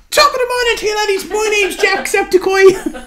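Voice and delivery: very high, loud mock Irish shriek; Jacksepticeye. Streamer imitating a channel intro, squealed Irish accent. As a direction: shout an over-the-top Irish greeting, verging on laughter. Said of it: "How does he do that?".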